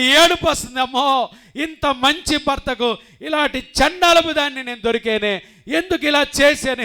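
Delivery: loud, high anguished lament; tearful wail. Acted. Wife confessing to the pastor, wailing in guilt.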